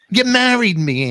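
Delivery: mock scolding wife.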